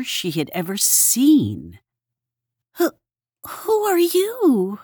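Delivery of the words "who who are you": scared Hedgehog. A narrator's reading. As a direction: stammer it out, frightened. Frightened Hedgehog voiced.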